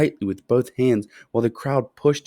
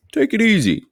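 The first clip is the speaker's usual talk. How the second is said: high, louder cautioning shopkeeper; shopkeeper warning; 13 semitones higher, 6 dB louder than usual. A narrator's reading. Shopkeeper cautioning Charlie not to gulp the candy, high and fussy. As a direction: pitch it up like a fussing shopkeeper. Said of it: "he said".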